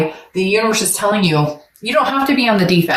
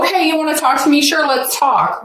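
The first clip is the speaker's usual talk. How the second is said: mock agreeable challenge; 8 semitones higher than usual. Acts out viewer's challenging reply.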